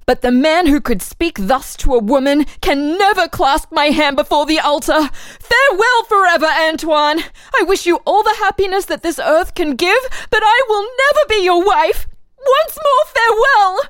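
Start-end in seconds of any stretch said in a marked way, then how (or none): 5.4-13.8 higher, rising with grief, final and firm; tearful farewell. Acted. Marie bids Antoine farewell forever, pained and higher. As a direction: break it off with a pained, lifted voice.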